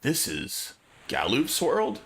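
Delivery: ordinary talk, nothing marked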